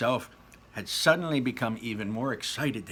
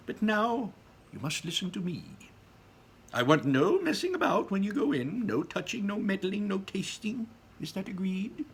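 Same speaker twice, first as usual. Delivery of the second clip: Wonka voice; 9 semitones higher than usual. Acted. Wonka laying down rules.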